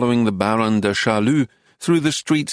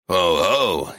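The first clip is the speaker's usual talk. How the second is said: wheezy cackle; 5 semitones lower than usual. Acted. Joshua cackles again.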